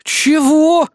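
high, loud, baffled "what?"; can't believe what he heard; surprised. A narrator's reading. Hero baffled by guard's reply.

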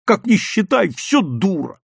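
hurt, helpless insult, drawn out; tearful outburst. A narrator's reading. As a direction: wail it through mock tears. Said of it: "и расплакался".

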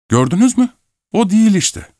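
very high, excited exclamation; triumphant retort. Acted. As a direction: pounce on it, pitch shooting up. Triumphant retort in acted quarrel.

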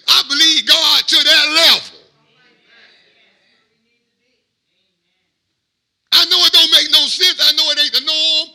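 high, loud, rising preacher's cry; preaching at full force. Acted. Preacher declaring his faith to the congregation, full-force preaching. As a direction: preach it out to the congregation with all you have.

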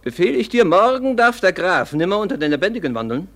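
loud, high, commanding order; harsh command. Acted. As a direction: bark the order at the servant. Herr befiehlt den Mord. (laut befehlend)

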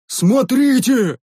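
high, slow, drawn-out yell; the same soldier yells again, slow and high; yelling warrior. Acted. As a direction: stretch the warning out as a shout. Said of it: "завопил".

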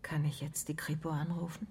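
tonlos und leiser gefragt; geflüstert. Acted. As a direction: vorsichtig flüsternd fragen. Detektiv fragt flüsternd nach. (Detektiv fragt die Mörderin behutsam, geflüstert)

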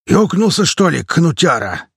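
slow, loud indignant burly man; indignant Klepan voice. A narrator's reading. Burly comrade indignantly asks if he's lost it. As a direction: bark it out as the bearded man. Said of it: "возмутилась девушка голосом Клепана".

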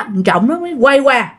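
ordinary delivery, nothing marked

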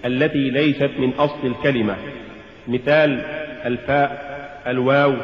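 usual speaking voice

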